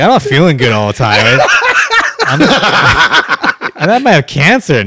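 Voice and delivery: laughing mock complaint. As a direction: joke voice breaking into laughter. Joking impression, breaking into laughter.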